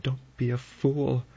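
high, pleading alarm; crying out. A narrator's reading. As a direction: plead urgently, pitched right up. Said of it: "She cried".

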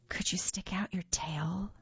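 breathy whispered question. A narrator's reading. The boy nervously asking the monster to show her tail, whispered. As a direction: ask in a nervous hush. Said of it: "I whispered".

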